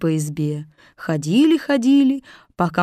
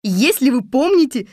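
higher, slightly louder helper voice; eager Little Muk; 6 semitones higher, 4 dB louder than usual. Acted. Little Muk to the captives, eager. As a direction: pipe up with an idea.

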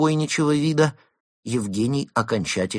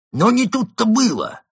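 high, loud threatening remark; menacing; 8 dB louder than usual. A narrator's reading. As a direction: lean in menacingly. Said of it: "грозно заметил одноухий".

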